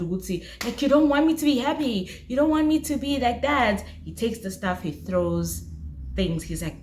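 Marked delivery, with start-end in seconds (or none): 0.8-3.8 higher, fast, mock-angry complaint; retelling an angry outburst in a mild mock voice; angry boyfriend. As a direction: act out his angry sulk, quickly. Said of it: "he's, like, in this angry mode".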